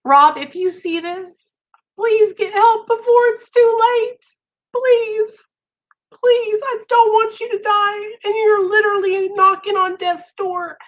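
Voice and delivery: real tearful plea to a friend. Real, not acted. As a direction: plead with him on the verge of crying. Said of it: "I'm about to cry".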